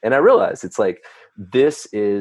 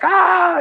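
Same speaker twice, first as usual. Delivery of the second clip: high, loud frustrated yell at nothing; yell at the mountain; 6 dB louder than usual. Retold or imitated. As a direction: shout your frustration into the dark. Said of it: "yelling at the mountain".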